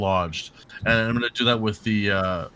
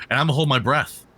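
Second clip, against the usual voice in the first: ordinary talk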